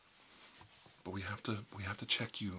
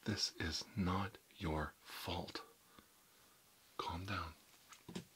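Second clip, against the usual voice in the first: slow whisper; 2 semitones lower, much slower than usual. Acted. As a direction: whisper it slowly, leaning on "not". Same line whispered slower.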